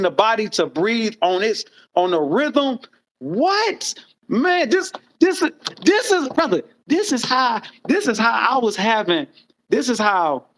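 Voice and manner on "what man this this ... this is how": excited. Real, not acted. Excited about his secret.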